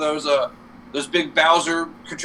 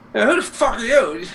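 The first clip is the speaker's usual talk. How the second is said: lightly voiced princess, pitched up; a higher mock challenge at the door; retold princess; 7 semitones higher than usual. Retelling the princess answering the door, high mock challenge. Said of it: "and she's like".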